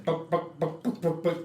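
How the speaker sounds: lightly voiced high chicken clucks; a touch of chicken clucking. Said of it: "the chicken comes back again".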